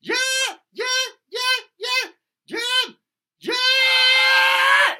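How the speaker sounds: very high, loud reinforced falsetto. Shown as a demonstration. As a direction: push the falsetto with power. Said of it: "just the reinforced falsetto".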